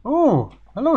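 high, bright mock greeting; squeaky hello. Players greeting a jungle guide, high put-on hello.

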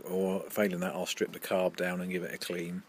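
talking around a cigar; cigar in mouth. Real, not acted. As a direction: lips closed on cigar. Seen 1: Cigar held at lips, then between lips from 1.1s on.

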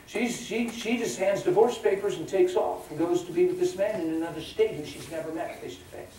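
ordinary talk, nothing marked

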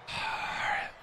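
long breathy exhale; defeated sigh. Real, not acted. Gamer unable to get the stop, weary sigh. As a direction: sigh out the frustration.

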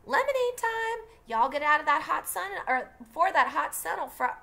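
high, loud call from the door. Acted. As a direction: summon the kids inside. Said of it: "Calpurnia appeared in the front door and yelled".

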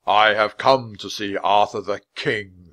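lightly voiced solemn knight, projected; a hint of a deep, resonant knight; deep knightly voice. A narrator's reading. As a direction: announce it like an imposing knight. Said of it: "His deep voice echoed from inside his helmet".

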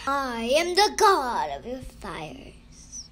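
loud, slow proclamation; thundering voice. A narrator's reading. God names himself to Moses, thundering. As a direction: declare it grandly, slow and loud. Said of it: "the voice said".

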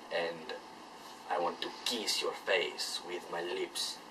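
put-on sexy Spanish accent. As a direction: purr it like a hammed-up Latin lover with a Spanish accent. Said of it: "sexy Spanish voice".

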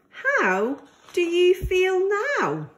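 ordinary delivery, nothing marked

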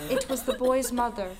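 ordinary delivery, nothing marked

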